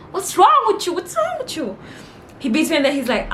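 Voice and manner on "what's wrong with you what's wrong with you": very high-pitched exasperated squeal; indignant scolding. Real, not acted. Pupil confronts teacher who beat her, squealing indignantly. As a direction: demand it twice, voice way up high.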